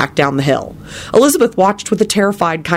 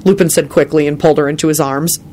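plain reading voice

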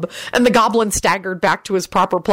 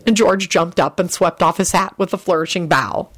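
ordinary delivery

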